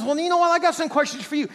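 high, loud mock challenge; God turning the tables. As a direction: fire the questioning straight back. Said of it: "God says".

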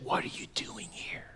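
very soft, breath-only whisper. Acted. God's voice asking Elijah on the mountain, gentle whisper.

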